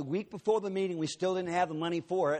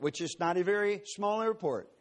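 normal talking voice

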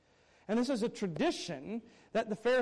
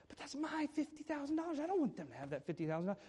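a lightly voiced stingy son, higher and softer; guard your money with a touch of mock greed; a greedy child refusing his parents the money; selfish child; 5 semitones higher, 7 dB quieter, faster than usual.

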